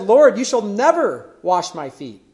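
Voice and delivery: Lord, you shall never wash my feet with never pressed hard and slow; emphatic refusal. Retold or imitated. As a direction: Peter flatly refusing, leaning on never. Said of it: "it's an emphatic".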